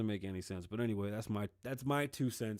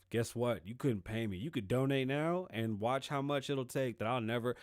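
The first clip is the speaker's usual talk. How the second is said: ordinary talk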